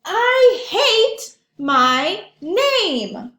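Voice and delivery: high, loud and very slow; a storybook child's loud outburst; strong feeling. Acted. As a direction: drag it out like a big confession. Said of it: "he feels very strongly about that".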